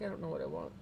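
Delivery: soft, faraway little cat voice, lightly done; voicing the cat. Voicing the cat's reply, a soft put-on little voice. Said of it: "He's like".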